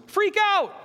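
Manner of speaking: hammed-up panic on freak out; mock freak out. Shown as a demonstration. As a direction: squeal freak out way up high and loud.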